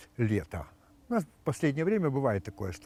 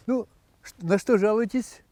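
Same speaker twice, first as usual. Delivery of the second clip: doctor voice; 8 semitones higher, 4 dB louder than usual. Acted. Doctor asks complaint.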